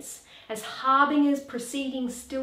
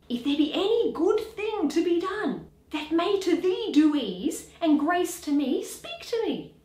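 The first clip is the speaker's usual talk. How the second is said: higher, pleading harder each line; rising plea; 6 semitones higher than usual. Acted. Horatio begs the silent ghost to speak, rising plea. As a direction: pitch climbing, begging the ghost.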